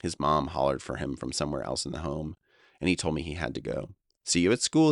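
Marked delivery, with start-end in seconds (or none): 4.2-4.9 higher, louder cheerful farewell; bright goodbye. Retold or imitated. Friend hanging up the phone, bright and cheerful. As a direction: sign off cheerfully. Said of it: "he said brightly".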